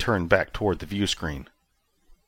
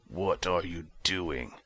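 much softer, slow puzzled murmur; muttered to himself; 10 dB quieter, slower than usual. A narrator's reading. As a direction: wonder aloud under your breath. Commander muses to himself.

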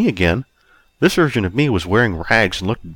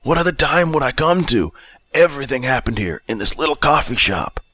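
put-on high, exasperated drunkard voice; destitute drunk Bob, annoyed; 7 semitones higher than usual. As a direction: complain as the annoyed down-and-out.

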